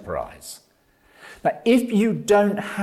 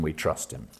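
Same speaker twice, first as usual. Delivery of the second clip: we trust him repeated much softer and lower; quiet echo; 9 semitones lower, 9 dB quieter than usual. Shown as a demonstration. Preacher repeats the line to the congregation, hushed and soft. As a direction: let it fall away to a hush.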